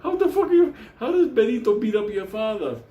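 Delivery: can't believe what you just heard; shocked disbelief. Retold or imitated. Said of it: "I was like".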